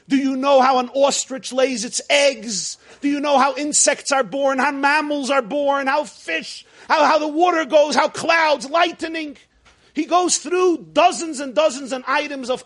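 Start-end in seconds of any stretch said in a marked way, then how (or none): 0.1-9.4 rising louder and higher, question after question; building challenge. Retold or imitated. God quizzing Job about creation, building to a shout. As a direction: pile on the questions until shouting. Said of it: "all he asks Eov is".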